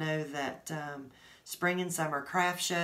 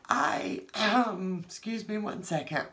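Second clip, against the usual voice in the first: choked up, voice climbing high; fighting back real tears, pitch rising; thanking viewers, voice breaking with tears; teary; 5 semitones higher, 4 dB louder than usual. Real, not acted. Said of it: "I'm getting teary".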